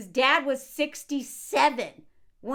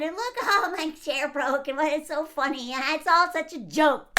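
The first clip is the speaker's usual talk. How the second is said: louder, higher mock amusement; mock his carefree bragging; mocking impersonation; 3 semitones higher, 3 dB louder than usual. Mockingly voices man joking at inauguration.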